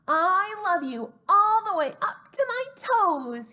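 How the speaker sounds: high, loud upside-down glee; excited little hare. Acted. Little hare tumbles and cries to father, high and loud. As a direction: shout it out upside down. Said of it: "he said".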